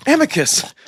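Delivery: shouting to rouse a passed-out friend; urgent shout. Acted. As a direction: yell to wake him.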